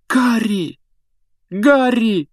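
high, loud panicked calling; frantic cry. Acted. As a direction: call out in alarm. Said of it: "воскликнула Гермиона".

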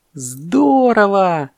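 high, drawn-out cheer; delighted monkey. Acted. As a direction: stretch it out with glee. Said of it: "обрадовалась мартышка".

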